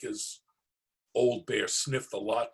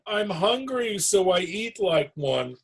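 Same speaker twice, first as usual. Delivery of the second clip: laughing; 8 semitones higher, 4 dB louder than usual. Acted. Bear laughing while talking.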